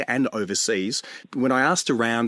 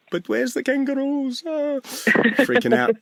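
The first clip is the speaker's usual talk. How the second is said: lightly voiced confused tourist, higher; slower than usual. Host mocking panicking tourists, a high confused voice. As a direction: ask it like a lost tourist, slow and high. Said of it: "the tourists who are like".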